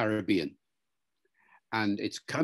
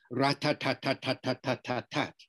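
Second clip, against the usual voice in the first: "rat-tat-tat" like even machine-gun beats; every "tat" equally weighted and short; syllable-timed rhythm. Shown as a demonstration. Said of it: "A syllable timed English is".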